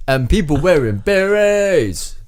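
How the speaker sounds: high, hammed-up accented wail; mock horror in a comic accent; stranded man's message. Stranded man reports berets, high comic wail. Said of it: "convey this message".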